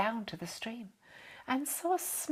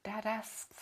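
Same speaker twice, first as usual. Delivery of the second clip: ordinary delivery, nothing marked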